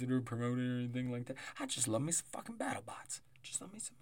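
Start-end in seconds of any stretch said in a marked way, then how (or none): 1.6-4.0 hushed whispered aside; whispering. Real, not acted. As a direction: whisper it like a secret.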